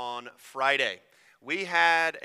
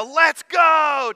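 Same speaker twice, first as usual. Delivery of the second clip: excited let's go; 12 semitones higher, 13 dB louder than usual. Shown as a demonstration. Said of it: "It's a ... type phrase".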